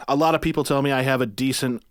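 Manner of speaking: usual speaking voice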